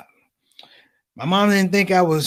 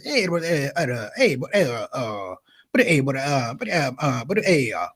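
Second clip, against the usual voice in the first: halting, lower sputtering like a cold engine; stalling old father; 5 semitones lower, 4 dB quieter than usual. Retold or imitated. Father trying to tell son mom's upset, halting and low. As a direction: sputter like a car that won't start. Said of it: "He sounded like this".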